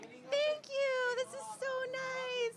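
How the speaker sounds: high, slow fake-polite thank you; fake gratitude. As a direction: gush a forced thanks. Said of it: "I have to awkwardly be like".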